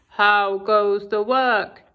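low, slow farmer call; lazy farmer calling. Acted. Lazy farmer calling from bed to the duck.

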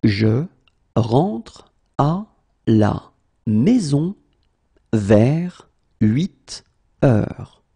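long pause between chunks; slow, in chunks. Shown as a demonstration. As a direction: lay it out chunk by chunk. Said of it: "teacher drills word by word".

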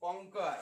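कॉन्कर said as kon-ker, no kyu sound; correct pronunciation. Shown as a demonstration. Teacher corrects pupil, saying कॉन्कर as kon-ker. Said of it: "कॉन्क्युअर".

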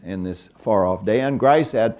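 ordinary talk, nothing marked

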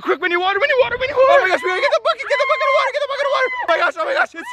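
put-on high frantic kid-toy voice; panicked shouting. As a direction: squeal it in a panic. Panicked toy fire scene.

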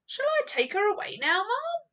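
put-on a nervous servant wanting the child gone; anxious maid. The maid's voice in story reading.

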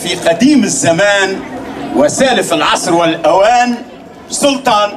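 put-on hakawati telling an old tale, unhurried; arabic grandma folk tale. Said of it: "اسمعوني توي". (صوت حكواتي يحكي خرافة)

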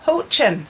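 "hochin" called out high and loud; "hochin" with a throaty "ch", sung out high; Ulster Scots word. Shown as a demonstration. Artist teaching viewers the Ulster Scots word "hochin", called out high.

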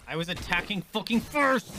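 real frustrated shout at the game; loud gamer rage; angry outburst. Real, not acted. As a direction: snap it out, fed up. Raging at unfair game attack.

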